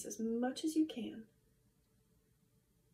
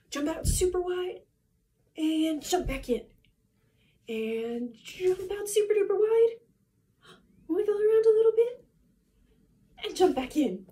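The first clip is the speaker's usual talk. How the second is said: loud, high, bouncy calls; excited cheer; 5 semitones higher, 8 dB louder than usual. Acted. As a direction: hype them up mid-workout. Energetic jumping-jack cue.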